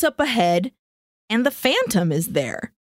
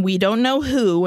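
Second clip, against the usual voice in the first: ordinary talk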